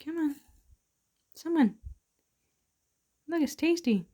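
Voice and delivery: high-pitched, pleading pet talk; coaxing a pet. Real, not acted. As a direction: coax reluctant pets to take food. Coaxing pets to eat.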